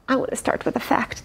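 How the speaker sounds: quick unvoiced whisper; fast hushed whisper. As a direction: rush it out as a secret. Whispered aside while reading.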